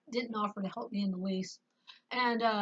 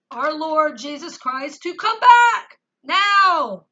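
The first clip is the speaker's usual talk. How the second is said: loud plea; 3 semitones higher, 13 dB louder, slower than usual. Real, not acted. Fed-up plea to God.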